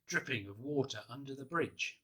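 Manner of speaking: plain reading voice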